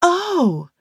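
high excited little oh; light up at the news; excited gasp. Acted.

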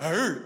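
low mock growl of a big tough man; big-guy growl. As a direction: growl low like a huge warrior. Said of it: "they were like really big guys".

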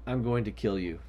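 low, deadpan mock menace; deadpan threat. Fed-up host to the joking co-host, deadpan threat. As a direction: drop the voice into a comic death threat.

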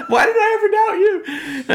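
very high, loud mock cry; elated relief. Speaker acting out relief to God, elated. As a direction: exclaim it way up high, overjoyed.

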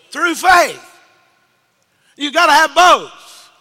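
loud, high sermon voice; preaching at full force. Acted. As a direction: drive it home to the congregation.

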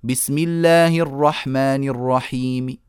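الرحيم ending with a faint third of the kasra; whispered trace of kasra on الرحيم; rawm on final meem. Shown as a demonstration. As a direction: end الرحيم with a barely voiced short i. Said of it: "أقف عليها بالروم هكذا".

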